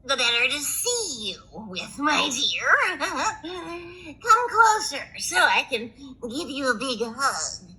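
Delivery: wolf barely hiding glee; wolf as grandma. Acted. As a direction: sly fake grandmother.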